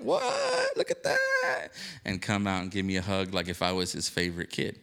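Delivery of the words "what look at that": mock squeal, much higher; imitating an overjoyed pastor spotting a friend; delighted surprise. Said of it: "be like".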